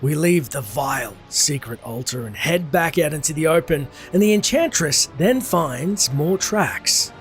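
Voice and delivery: ordinary delivery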